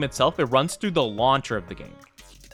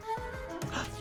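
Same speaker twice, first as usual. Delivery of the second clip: excited gasp; 13 dB quieter than usual. Real, not acted. Surprised by a raid.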